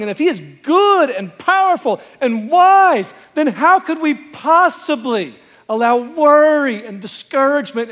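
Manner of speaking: loud, high, driving preacher's climax; full-force preaching. Acted. Pastor presses the congregation in a loud, high climax. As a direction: build the question to a ringing peak.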